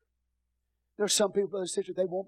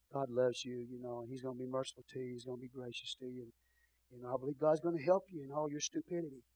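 gentle mercy; 4 semitones lower, 8 dB quieter than usual. Shown as a demonstration. Demonstrates the gentle mercy approach.

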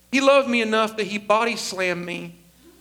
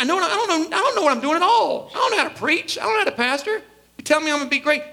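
higher, pleading voice of his unsure younger self; anxious younger self; 7 semitones higher, faster than usual. Retold or imitated. Young pastor telling flatterers he's clueless, higher and pleading. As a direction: protest your own doubts, pitched up. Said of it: "I was like".

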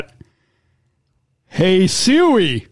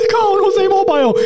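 put-on lightly done Irish accent, higher pitch; mock Irish accent; 11 semitones higher than usual. Host mimics Siri's voice in a mock Irish accent. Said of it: "An Irish accent".

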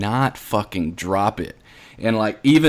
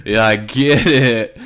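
mock dawning realization; 3 semitones higher, 6 dB louder, much slower than usual. Class reacts, dawning realization.

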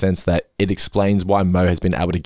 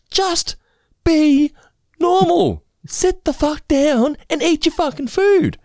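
exasperated parent; slower than usual. Retold or imitated. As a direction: snap at the kids, at the end of your rope.

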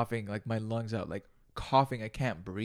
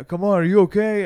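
loud, higher call through a door; loud knocking call; 7 semitones higher, 11 dB louder than usual. Retold or imitated. People outside the restroom calling loud.

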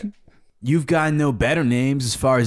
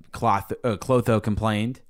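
usual speaking voice

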